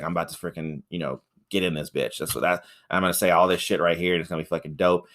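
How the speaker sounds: lightly voiced smug boyfriend muttering; smug inner voice. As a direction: play a guy psyching himself up. Peter's inner voice retold.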